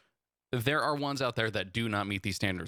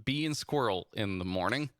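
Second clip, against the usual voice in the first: usual speaking voice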